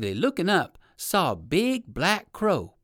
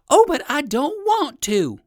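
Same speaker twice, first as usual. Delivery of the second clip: put-on high, louder protest from the old rabbit; objecting rabbit; 13 semitones higher, 7 dB louder than usual. Old rabbit refusing the alligator, high pleading protest. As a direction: push back, pleading, a notch up. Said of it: "objected the rabbit".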